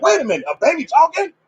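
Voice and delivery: high, mock-astonished reaction; incredulous. Preacher imagines hearing a baby talk, acted incredulous. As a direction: act out disbelief, pitch jumping up.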